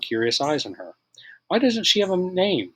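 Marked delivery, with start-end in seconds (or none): none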